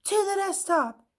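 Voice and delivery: rally everyone with a cry; shouted. A narrator's reading. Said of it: "Chinchetta shouted".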